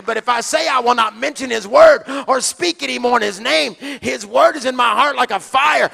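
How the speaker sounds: preacher shouting the verse at full force; shouted verse. Shown as a demonstration. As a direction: roar it like fire shut up in the bones. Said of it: "like a caged lion".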